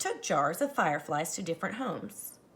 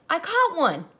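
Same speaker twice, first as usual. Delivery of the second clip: a higher, louder triumphant call; 8 semitones higher, 7 dB louder than usual. Acted. The boy calls out over his friends, triumphant call. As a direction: ring out above the others. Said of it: "a voice called out".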